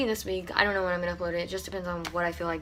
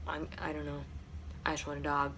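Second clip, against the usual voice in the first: soft, choked-up voice on the verge of crying; fighting off real tears; holding back tears; 3 semitones lower, 10 dB quieter than usual. Real, not acted. Missing her dead dog, close to tears, quiet and choked. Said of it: "I'm about to cry".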